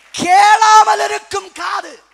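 very loud, high, drawn-out shout; thundering proclamation. Real, not acted. Preacher proclaiming the heading to congregation, full slow shout. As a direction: stretch கேளாமல் into a huge cry.

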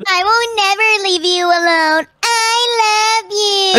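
very slow, drawn-out lovey voice; lovesick drawl. Acted. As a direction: swoon it out dramatically. Lovesick sister clings to him.

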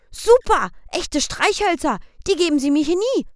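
high, loud delighted squeal; high thrilled child voice; thrilled rich boy. A narrator's reading. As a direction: gush like a kid given a treat.

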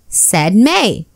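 ordinary delivery, nothing marked

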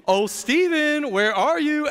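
high, slow teasing call; playful sing-song seeking. Retold or imitated. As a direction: pretend you can't find the kid. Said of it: "you're walking by going".

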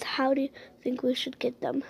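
fast whispered aside to the viewers; conspiratorial whisper. Pretend. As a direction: let the audience in on a secret plan. Secret aside to viewers about stealing.

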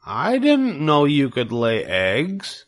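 high, slow, wide-eyed pig voice; amazed piglet. Acted. Wilbur amazed Charlotte can lay eggs, high slow wonder. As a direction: marvel at it like a young pig. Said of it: "said Wilbur in amazement".

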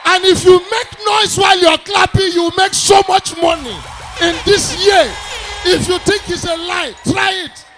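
loud preacher-style crowd hype; hype shouting. Pretend. Comedian rousing audience to clap, shouting.

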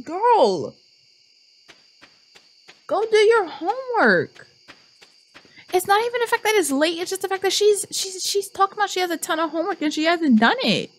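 high-pitched, worked-up rant; exasperated scolding. Real, not acted. Player tells Abigail to do homework, high exasperated scolding.

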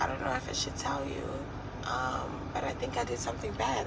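very soft, quick and hesitant; hushed tearful confession. Retold or imitated. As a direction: confess it barely above a breath. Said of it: "but she's crying".

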